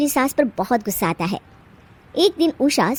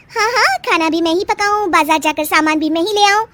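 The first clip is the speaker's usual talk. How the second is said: higher mocking taunt; sarcastic young wife; 8 semitones higher than usual. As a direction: needle her. Bride taunts sister-in-law.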